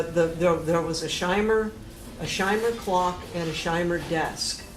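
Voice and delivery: ordinary delivery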